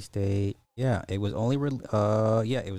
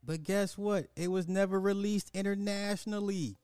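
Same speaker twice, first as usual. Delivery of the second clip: much higher, fast mocking rant; 8 semitones higher than usual. Vlogger mocking international audiences in a high-pitched rant. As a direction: rant in a raised squeaky voice.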